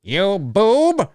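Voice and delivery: high, loud villain insult; cartoon villain voice. Fan imitates the He-Man cartoon insult, high villain voice. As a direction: hurl it as a cackly cartoon villain.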